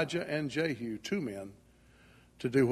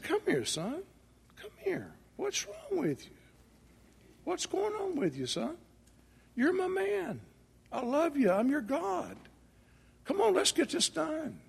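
much higher and much softer, tender God coaxing; still small voice; 13 semitones higher, 4 dB quieter than usual. Acted. God tenderly calls the discouraged Elijah near, high and hushed. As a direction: coax him gently like a loving father. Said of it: "spoke to him in a still small voice".

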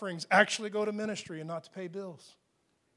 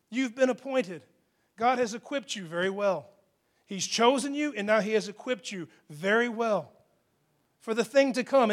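normal talking voice

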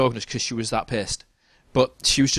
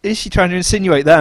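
mock pity; 7 semitones higher, 5 dB louder than usual. Narrator reacting to her lonely hint, higher mock pity. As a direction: pity the dog, pitched up in disbelief. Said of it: "I was like".

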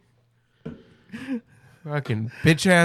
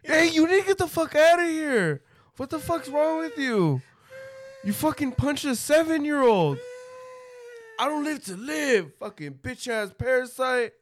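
high, raised voice yelling; hammed-up scolding; mock shouting at the man who punched a kid; mock angry bystander; 10 semitones higher than usual. Acting out the gym confrontation.

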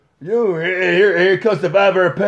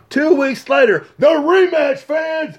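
wrestling hype announcer; 6 semitones higher than usual. Acted. Announcer hyping the rematch to the fans, booming. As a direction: sell the rematch to the crowd.

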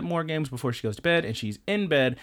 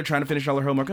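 ordinary delivery

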